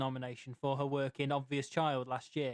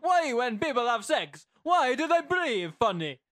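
slow, high, shouted mock-Italian accent; hammed-up Italian father shout; aggressive, vaguely Italian father; 7 semitones higher, 8 dB louder than usual. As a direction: bellow it like an angry Italian dad. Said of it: "aggressive, vaguely Italian father".

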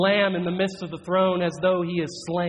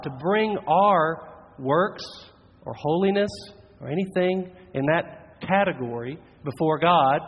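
normal talking voice

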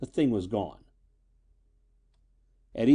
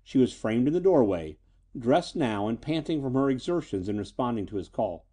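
plain reading voice

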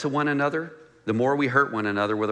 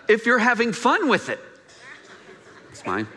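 put-on higher, playful kid's claim ending in a laugh; whiny toddler voice; 8 semitones higher than usual. Pastor voicing a greedy toddler, higher playful kid voice. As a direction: sing it like a greedy child grabbing a toy.

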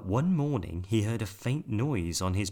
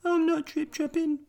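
very high squeaky mouse; tiny mouse voice; 5 dB louder than usual. A narrator's reading. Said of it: "said a small furry creature".

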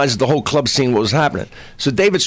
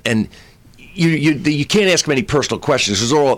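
ordinary delivery

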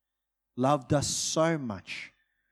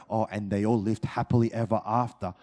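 lower, quick, mock-breezy "happily ever after"; naive fairy-tale ending; 8 semitones lower, faster than usual. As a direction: toss it off like a children's story ending. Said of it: "You can't read the story of Jesus and go".

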